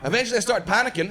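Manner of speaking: ordinary talk, nothing marked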